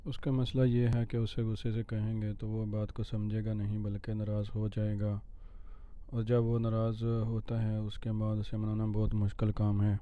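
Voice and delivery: softly, patiently explaining; gentle explaining. A narrator's reading. Brother explaining to the teacher, soft and patient. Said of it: "احان نے نرمی سے وفا کو سمجھایا".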